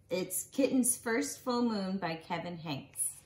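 usual speaking voice

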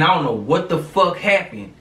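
normal talking voice

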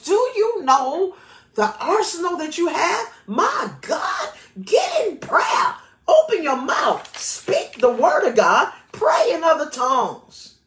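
high, stretched-out preaching cry; fervent exhortation. Real, not acted. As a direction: urge them to pray, pitched high and insistent. Urgent exhortation in sermon.

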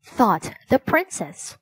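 ordinary delivery, nothing marked

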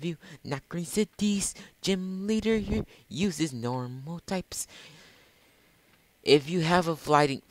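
high-pitched put-on helper voice; helpful game character.

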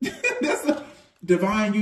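laughing through the words; real laughter breaking in. Real, not acted. Laughing mid-reading.

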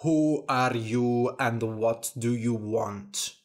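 ordinary delivery, nothing marked

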